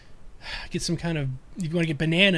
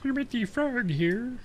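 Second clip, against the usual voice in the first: higher, slow froggy puppet voice; Kermit the Frog impression; 8 semitones higher, slower than usual. S1 showing the frog sound of his character, higher and slow. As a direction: squeeze it up into a silly frog puppet. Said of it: "that Kermity sound".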